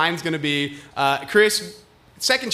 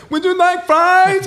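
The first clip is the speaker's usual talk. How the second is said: high, slow, loud sing-song imitation of the girl; mimicking the employee's voice; 14 semitones higher, 7 dB louder, slower than usual. Imitating the McDonald's worker at the window, high and slow. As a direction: pitch it way up and stretch the words out. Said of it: "the most two different voices coming through".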